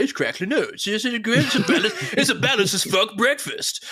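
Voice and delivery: high comic cereal-advert puppet. Retold or imitated. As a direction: do a silly puppet pitching breakfast, laughter breaking in. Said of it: "that voice has now stuck with me".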